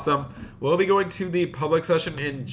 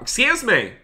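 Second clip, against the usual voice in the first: sharp call out; 10 semitones higher than usual. Acted. Banker yelling at a man on his counter, sharp call. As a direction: call out sharply across the bank. Banker shouting at brick thief.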